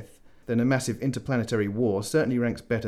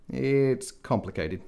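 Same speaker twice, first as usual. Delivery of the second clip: slow, reluctant admission; conceding; 3 semitones higher, much slower than usual. Acted. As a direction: draw it out, giving in. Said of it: "he conceded".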